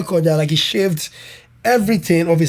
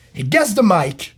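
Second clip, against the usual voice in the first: ordinary talk, nothing marked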